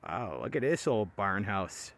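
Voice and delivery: ordinary delivery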